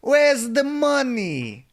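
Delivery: put-on high, loud, slow Eastern European accent; GTA cousin voice. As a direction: drag it out like the cousin. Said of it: "Just repeating G T A four".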